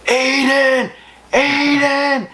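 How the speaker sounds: high, drawn-out mock shout of a name; imitated yelling. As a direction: call out like a friend yelling for help. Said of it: "What are you yelling about".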